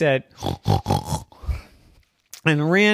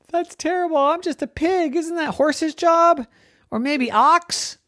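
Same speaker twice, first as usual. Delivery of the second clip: whining piglet; 3 dB louder than usual. Acted. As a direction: complain like a put-upon little pig. Pig protests the chore.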